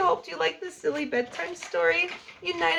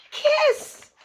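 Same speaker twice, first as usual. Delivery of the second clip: big kiss; 14 semitones higher, 7 dB louder than usual. A narrator's reading. Sleepy kiss punchline.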